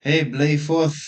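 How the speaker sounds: normal talking voice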